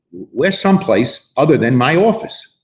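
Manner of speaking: ordinary delivery, nothing marked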